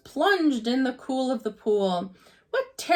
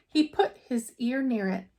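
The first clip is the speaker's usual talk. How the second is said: plain reading voice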